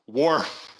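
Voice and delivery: joke-telling dog imitation, loud and sudden; dog bark. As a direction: bark the word like a big woof. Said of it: "The dog says".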